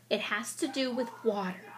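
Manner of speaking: ordinary talk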